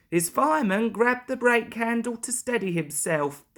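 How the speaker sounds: usual speaking voice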